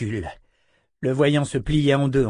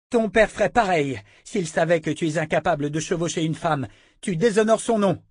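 higher, sneering taunt; mocking woman; 7 semitones higher than usual. A narrator's reading. Captive woman humiliates the brute, sneering. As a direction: belittle him with scorn.

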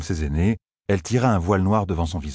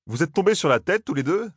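Matt's incredulous question; 11 semitones higher than usual. A narrator's reading. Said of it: "demanda Matt".

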